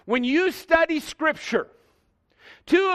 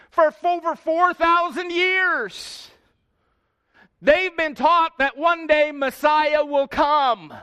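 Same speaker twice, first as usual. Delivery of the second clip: slow, raised preacher's proclamation; emphatic preaching; 4 semitones higher, slower than usual. Real, not acted. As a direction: draw it out high and let it ring over the congregation. Preacher building to emphatic climax.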